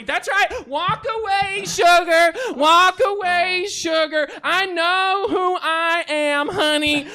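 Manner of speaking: loud, high, hammed-up Western twang; Western accent. Shown as a demonstration. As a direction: holler it sassily in a Western accent. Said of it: "throw in a Western accent".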